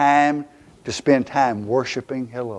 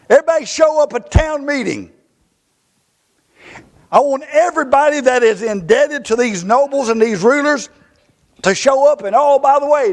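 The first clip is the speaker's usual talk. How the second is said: much higher, loud summons to the whole town; calling everyone together; 10 semitones higher, 4 dB louder than usual. Acted. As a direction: call the crowd in like a leader. Nehemiah summoning the town assembly.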